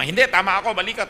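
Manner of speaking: a stubborn sibling snapping back in a quarrel, loud; insistent quarrel shout. Retold or imitated.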